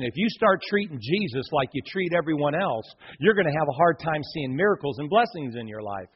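ordinary talk

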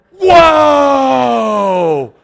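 long, loud, high "whoa", then slow amazement; hammed-up, high-pitched excitement; thrilled shouting. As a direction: imitate awestruck boys yelling. Boys thrilled by live mannequin.

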